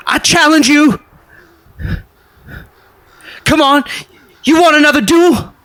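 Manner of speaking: high, loud, squeaky bravado; squeaky young squire. Acted. Squire points his sword at the duelist, squeaky shouted challenge. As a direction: yelp it like an overeager young knight. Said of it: "you hear this squeaky voice".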